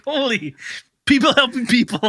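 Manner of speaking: high, loud ironic shock; mock astonishment. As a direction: throw the motto back sarcastically. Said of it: "we're like".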